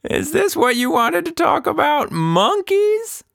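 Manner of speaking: put-on high, thin old woman's voice; an old lady cackling over monkeys; old Anita laughing. A narrator's reading. Anita mocking Tilda about monkeys, in a high old woman's voice. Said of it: "Anita wiped a tear from her eye".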